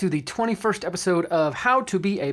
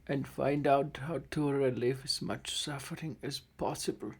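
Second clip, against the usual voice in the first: somber game master narration; 3 semitones lower, 6 dB quieter than usual. A narrator's reading. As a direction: narrate the death scene gently. Game master narrates dying gnome.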